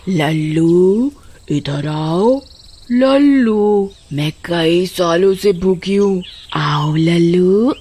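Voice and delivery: slow, low eerie witch calling his name; luring witch. Acted. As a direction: draw Lallu in with a hungry witch's call. Witch lures Lallu by name.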